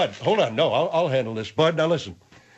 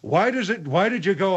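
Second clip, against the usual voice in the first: ordinary talk, nothing marked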